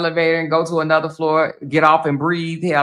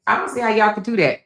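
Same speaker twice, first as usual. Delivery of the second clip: ordinary talk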